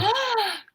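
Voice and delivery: sharp high gasp of mock outrage; shocked gasp. Real, not acted. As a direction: gasp as if offended. Shocked by dislike of beans.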